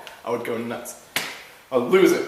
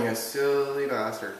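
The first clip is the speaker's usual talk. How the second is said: weary dismissal; 3 semitones lower, 8 dB quieter than usual. Retold or imitated. Retold reaction to Brian's antics.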